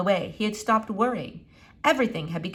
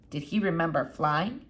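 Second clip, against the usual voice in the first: usual speaking voice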